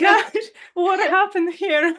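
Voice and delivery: high, loud, slowed-down gasp of shock; shocked exclamation. Retold or imitated. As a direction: blurt it out, high and loud, caught off guard. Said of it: "And I said".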